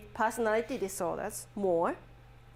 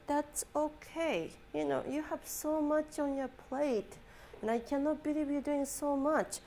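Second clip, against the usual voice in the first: soft, higher-pitched consoling voice; retold comforting words, softly lifted and unhurried; gentle reassurance; 4 semitones higher, 5 dB quieter than usual. Professor comforting crying student, retold.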